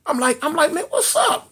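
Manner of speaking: a nervous squeak of a question; high anxious plea. Retold or imitated. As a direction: beg her to spill it.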